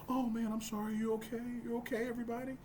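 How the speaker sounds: high, apologetic concern; mock apology. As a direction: pretend to fuss over a hurt partner.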